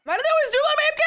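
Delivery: fast, high, loud exasperation; excited complaint. Real, not acted. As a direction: rattle it off in disbelief. Complains about missing subs.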